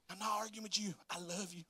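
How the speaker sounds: soft, placating sweet talk; mock soothing. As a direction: refuse the fight in a softened voice. Acted soothing reply to wife.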